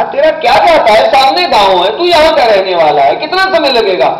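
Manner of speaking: high, loud, exasperated traveller; acted angry outburst. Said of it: "गुस्सा खा करके चल दिया".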